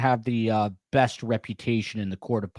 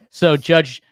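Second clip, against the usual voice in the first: laughing; 5 semitones higher, 8 dB louder than usual. Real, not acted. Laughing while telling.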